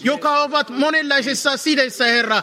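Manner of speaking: korkealla äänellä, kiihkeästi rukoillen; palava esirukous. Real, not acted. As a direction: huuda Herraa avuksi sairaiden puolesta. Fervent intercession for the sick. (seurakunnan edessä kiihkeä esirukous, korkealla)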